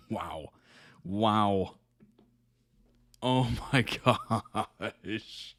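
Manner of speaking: slow words broken up by real laughter; laughing to tears. Real, not acted. Viewer still tearing up over the funny clip, laughing through the words. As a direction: let a laugh split the drawn-out phrase. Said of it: "I'm, like, legitimately tearing up".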